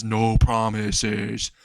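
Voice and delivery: very slow, dragged-out zombie voice; zombie drawl. Acted. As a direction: stretch each word like a lazy zombie. Zombie Fred teases Izuku.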